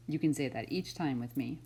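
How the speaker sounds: loud, bright storybook read-aloud; reading to children. Acted. Teacher reading a picture book to kindergartners, loud and lively. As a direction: read it big for a class of kids.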